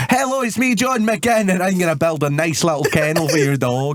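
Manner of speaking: a light mock of a cheery advert builder; hammed-up advert voice. Acting John McGinn in an advert.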